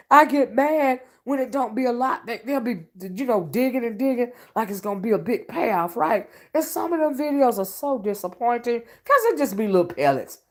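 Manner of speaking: normal talking voice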